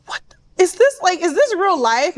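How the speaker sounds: high, incredulous questioning; stunned disbelief. Retold or imitated. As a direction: question it as if reeling. Stunned while overhearing the quarrel.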